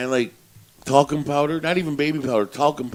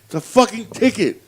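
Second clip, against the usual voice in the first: annoyed friend; 8 semitones higher, 6 dB louder than usual. Retold or imitated. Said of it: "He goes".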